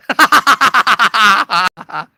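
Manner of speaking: loud laugh at own joke; loud laughter. Real, not acted. Comedian laughing loud at his own joke. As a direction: crack up loudly.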